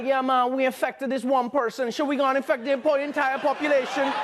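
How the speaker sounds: chilled Jamaican patois voice; Jamaican accent. Retold or imitated. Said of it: "Jamaican diseases are chilled out".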